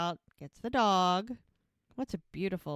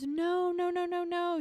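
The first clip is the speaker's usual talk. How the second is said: high-pitched panicky string of refusals; freaking out; 11 semitones higher, 4 dB louder than usual. Retold or imitated. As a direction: imitate someone in a sudden panic, pitched way up. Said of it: "Hope freaks out".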